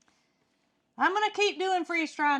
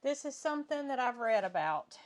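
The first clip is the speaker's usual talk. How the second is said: usual speaking voice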